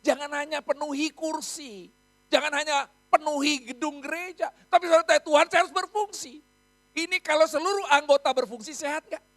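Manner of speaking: loud, rising preacher's call; preaching at full force. Acted. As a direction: urge the whole church to serve. Pastor's call to serve. (khotbah berapi-api dengan suara lantang)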